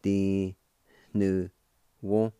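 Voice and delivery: "Di. nu wo" one held syllable at a time; syllable-by-syllable pronunciation. Shown as a demonstration. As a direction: sound out "Di. nu wo" with clear pauses between syllables. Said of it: "First part is pronounced like this".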